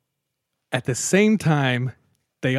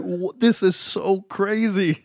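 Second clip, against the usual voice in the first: high, drawn-out amazement; raised-pitch incredulous exclamation; stunned disbelief; 9 semitones higher, 4 dB louder, slower than usual. Retold or imitated. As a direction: stammer into it, slow and astonished. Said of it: "my jaw dropped".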